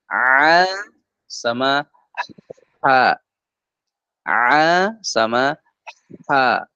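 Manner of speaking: A and H from mid-throat, slow; middle throat letters. Shown as a demonstration. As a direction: sound ain and ha from the mid-throat. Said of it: "bagian tengah, di sini". (ain dan ha dari tengah tenggorokan)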